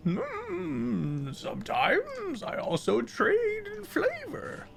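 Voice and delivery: put-on high, airy playful old fey; high fey trickster voice. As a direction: tease like a whimsical fey trader. GM voices Wizen, high far voice.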